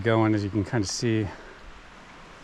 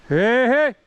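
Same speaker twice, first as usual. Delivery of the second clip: loud high shout of hey; calling out; 13 semitones higher, 11 dB louder than usual. Real, not acted. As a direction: yell out to warn whatever's there. Calling out alone in woods.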